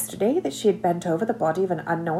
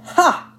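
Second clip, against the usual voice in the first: one sharp high laugh syllable; one bright burst of laughter; single laugh; 10 semitones higher, 3 dB louder than usual. Acted. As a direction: let out a single surprised laugh. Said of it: "It was a single syllable".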